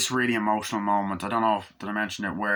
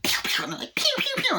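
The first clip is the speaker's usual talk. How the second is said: high mock pew pew of guns; gunshot noises. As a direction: fire off cartoon shots. Imitating gunfire.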